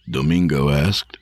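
ordinary delivery, nothing marked

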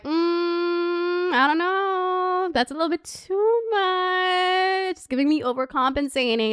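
skeptical. Retold or imitated. Skeptical inner judging voice.

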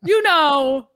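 high, mocking parody of the friend; mocking imitation. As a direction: mimic him with a squeaky, teasing voice. Mocking the friend's vague question.

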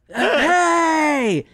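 high, drawn-out in-your-face shout; mock drunk holler. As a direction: blast a greeting like a drunk guy. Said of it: "where with beer breath, it's like".

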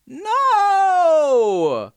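shouted high no, half laughing; loud exasperated no. Real, not acted. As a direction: shout it down, amused frustration. Another wrong guess.

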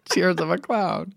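laughing it out slowly. Real, not acted. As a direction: say it through a laugh. Laughing self-mockery.

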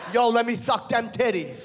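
lisping inmate. Acted. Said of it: "the lisp makes it more humiliating".

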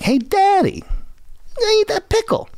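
put-on high squeaky kid asking his dad; little kid voice. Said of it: "this kid would be like".

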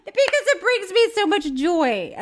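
high, choked-up laughing voice; tearful joy. Real, not acted. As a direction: overcome with happy tears, laughing through them. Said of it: "She's crying".

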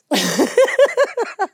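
high-pitched helpless laugh; real laugh, near the verge of tears; laughing hard. Real, not acted. Host cracking up at her friend's reaction, laughing hard. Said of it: "I'm, like, laughing".

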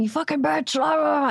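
high-pitched, half-hearted rant; imitated angry yelling. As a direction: imitate a man ranting, trailing into blahs.

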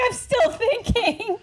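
imitating Nolan in a high, slow voice through laughter; drawn-out squeaky mock stalling; teasing a co-host who forgot to pick. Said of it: "Nolan's like".

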